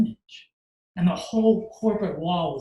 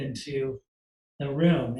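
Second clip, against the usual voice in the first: ordinary talk, nothing marked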